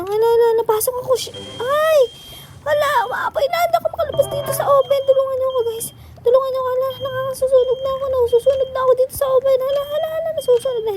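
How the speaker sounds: very high, fast, loud panicked cries; playfully panicking, trapped in a burning oven; mock panic. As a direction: squeal for help as if burning.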